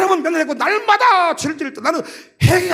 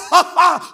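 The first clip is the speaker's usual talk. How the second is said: mock hearty laugh; 2 semitones higher, 5 dB louder, much slower than usual. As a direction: burst of acted laughter. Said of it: "자다가도 벌떡 일어나서". (자다 깨서 터뜨리는 웃음 흉내)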